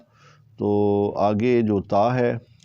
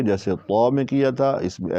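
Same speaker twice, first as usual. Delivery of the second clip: ordinary talk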